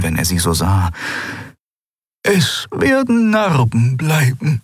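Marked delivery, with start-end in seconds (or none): 2.2-4.6 put-on a high, slow old healer's voice; lightly voiced elderly healer, unhurried; old healer Xea. A narrator's reading. Healer Xea tells Endriel about the scars, high and slow.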